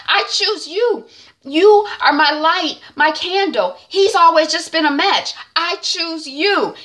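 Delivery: a mildly hammed-up wife begging to be chosen; pleading wife. Helen pleading with her husband Paul after his jealousy, high and slow.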